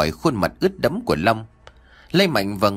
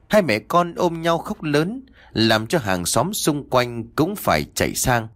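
plain reading voice

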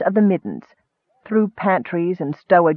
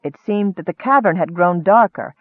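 ordinary delivery

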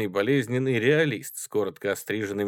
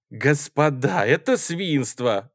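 loud, slow, raised-pitch outcry; indignant exclamation; 5 semitones higher, 6 dB louder, slower than usual. A narrator's reading. Schoolboy protesting to the gentlemen, exclaiming indignantly. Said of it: "воскликнул хорошенький гимназист".

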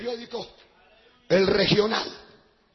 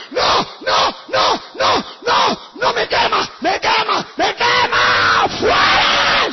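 high, loud shrieks of burning; demon screaming; 9 semitones higher, 8 dB louder, much slower than usual. Retold or imitated. Demon cries out under God's fire, high screaming. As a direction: scream it high, stretching to the last word. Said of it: "El demonio comenzó a gritar".

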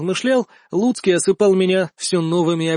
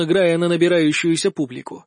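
plain reading voice

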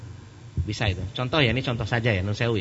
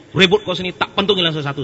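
put-on high, loud threat at brawling kids; angry threatening yell; 7 semitones higher, 7 dB louder than usual. Preacher yelling at teenagers about to brawl, loud angry threat. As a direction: bellow it like you'll swing the stick. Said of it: "Saya teriak, suara saya kan kalau marah gede".

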